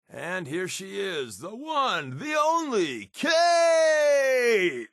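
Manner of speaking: building from hushed to a big high shout; showman intro. Acted. Deacon hyping up Kate's arrival, announcer building to a shout. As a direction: announce her like a ring announcer, climbing to a roar.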